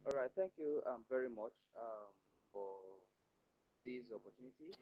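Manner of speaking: very faint, distant voice; barely audible. Real, not acted. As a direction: voice fading almost to nothing. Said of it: "Your voice was very low".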